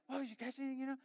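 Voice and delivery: high, small-voiced child imitation; eager kid. Kids to every person fishing, eager mimicked child voice. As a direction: imitate a child pestering, pitched well up.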